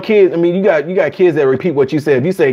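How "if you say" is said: ordinary delivery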